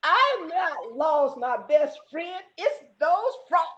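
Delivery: slow, drawn-out lament; put-on disgusted whine; downhearted possum. A narrator's reading. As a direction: bemoan it with mock misery. Downhearted possum answers his friend.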